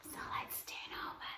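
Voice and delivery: fast whispered instruction; brisk hushed cue; quick whisper. Acted. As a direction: whisper it quickly as you move. Teacher guiding yoga.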